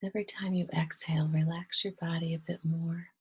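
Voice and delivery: soft, hushed meditation voice with long pauses; quiet, unhurried breath-led calm; guided meditation. Real, not acted. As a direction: gently guide them into relaxation, leaving space to breathe.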